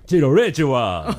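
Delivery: slow, hammed-up monster villain greeting. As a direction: drawl it like a theatrical villain. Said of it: "また怪人風に言ってみてる".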